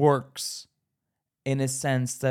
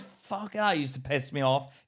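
ordinary delivery

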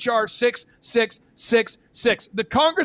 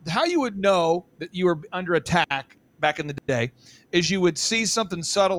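ordinary talk, nothing marked

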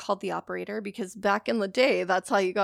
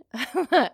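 short laugh; 4 semitones higher, 6 dB louder than usual. Real, not acted. Laughing at empty glass.

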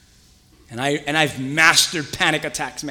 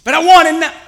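put-on high, loud childish whine; whiny impatient believer; 12 semitones higher, 12 dB louder than usual. Believer complaining to God, high and whiny. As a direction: beg like a kid who can't wait.

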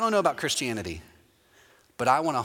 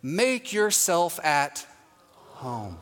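drawn-out phrase; much slower than usual. Retold or imitated. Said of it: "And then she says this phrase".

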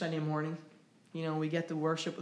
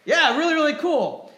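excited; 10 semitones higher, 11 dB louder than usual. Real, not acted. Excited about friends' hike.

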